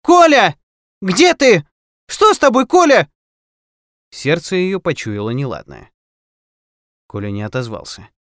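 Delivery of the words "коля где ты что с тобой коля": put-on high, loud calling for a child; worried mother's yell; louder shout. A narrator's reading. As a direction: shout out for him, voice pitched way up. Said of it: "крикнула громче".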